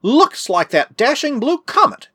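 high, drawn-out excited guess; excited answer. A narrator's reading. Excited friend answers at telescope.

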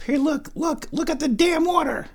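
high coaxing pet voice; cat talk. Real, not acted. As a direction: coax the cat to look. Showing cat the water.

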